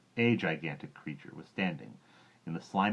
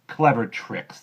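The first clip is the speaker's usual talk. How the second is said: scornful echo; 6 semitones higher, 11 dB louder, slower than usual. Acted. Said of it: "the not-so-big one said".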